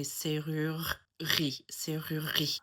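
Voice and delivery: serrurerie in a Parisian accent, middle E dropped. Shown as a demonstration. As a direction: Parisian French, run the R's of serrurerie together without the E. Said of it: "in a Parisian accent".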